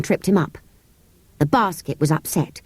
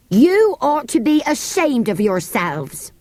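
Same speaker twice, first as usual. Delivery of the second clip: slow, higher scolding housekeeper voice; scolding maid; 5 semitones higher, slower than usual. Acted. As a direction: tell the children off from the doorway. Angry maid scolds children.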